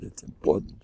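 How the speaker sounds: very soft, trailing repeat; hushed aside. Real, not acted. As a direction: drop it almost to nothing.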